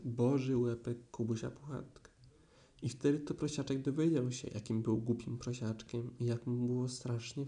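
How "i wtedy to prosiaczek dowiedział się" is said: usual speaking voice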